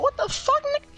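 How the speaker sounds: very high, startled squeal; mock-panicked yelp at the teeth; shocked what the fuck. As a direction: shoot way up in pitch in disbelief. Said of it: "I was like".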